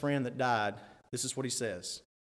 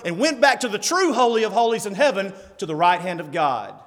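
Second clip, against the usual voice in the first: full-force preaching; 8 semitones higher, 9 dB louder than usual. Acted. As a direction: thunder it out from the pulpit.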